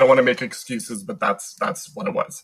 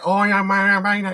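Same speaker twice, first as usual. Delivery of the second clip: high-pitched mock pub drunk; imitating drunk Brit; 9 semitones higher than usual. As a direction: garble it like a drunk Brit at the bar. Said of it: "like, drunk in, like, a bar".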